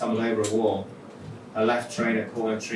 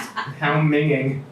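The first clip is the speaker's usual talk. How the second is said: higher, louder, very slow gasp of disgust; 5 semitones higher, 5 dB louder, much slower than usual. Acted. Nicole reacts to the naked man, appalled gasp. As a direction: drag it out, appalled. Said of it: "She gasped".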